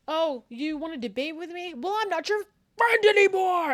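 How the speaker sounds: high indignation building to a yell; hammed-up friendship-ending tantrum; mock outburst. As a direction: start affronted, end in a high shout. Acted quarrel with debating friend.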